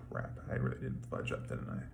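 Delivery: a sinking, guilty inner voice; whispered realization. Retold or imitated. David realizing he sinned, a dismayed whisper.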